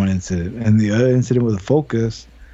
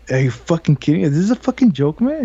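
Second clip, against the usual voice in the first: disbelieving; 7 semitones higher than usual. Retold or imitated. Retold disbelief at crash.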